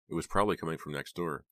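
ordinary delivery, nothing marked

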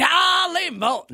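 high, slow, savoring Charlie Morton; drawn-out Charlie Morton. Pretend. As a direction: stretch out Charlie Morton like a fan chant. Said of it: "I like to call him Charlie Morton".

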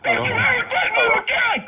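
unhinged, scream every threat; husband roaring threats down the phone, enraged; enraged shout. Real, not acted. Caller rages at threat to family.

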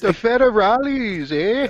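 put-on high, slow Canadian accent; thick Canadian accent. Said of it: "The thickest, fakest accent ever".

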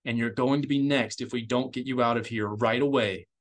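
ordinary delivery

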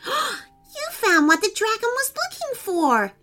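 very high, wonder-struck exclamation; amazed. Acted. Rain Kitty sees the golden star, amazed. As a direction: marvel at it, voice shooting up. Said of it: "said an amazed Rain Kitty".

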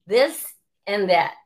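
a light caricature of a sexist heckler; mock sneering player. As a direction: mimic the jeering player with a sneer. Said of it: "they started saying".

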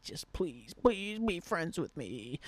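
drawn-out, wheedling mock plea; mock pleading. Mimicking hero worshippers begging for friendship, mock pleading. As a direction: beg like a fawning fan, stretching it out.

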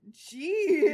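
loud stunned gasp of a word; shocked exclamation. Real, not acted. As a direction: blurt it out in disbelief. Reacting to the steamy passage.

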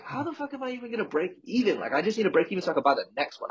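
panicking over breaking even, voice jumping up; exasperated inner voice. Retold or imitated. To the podcast host, recalling debt panic, high exasperated voice. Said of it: "And I was like".

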